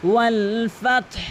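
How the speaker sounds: ওয়াল ফাতহ ending in a breathy h; final h sounded. Shown as a demonstration. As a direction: let breath out after t in ফাতহ. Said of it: "এভাবে উচ্চারণ করবেন".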